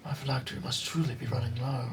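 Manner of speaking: put-on weary old undead voice, lightly done; a tired ancient skull, half-voiced; ancient lich voice.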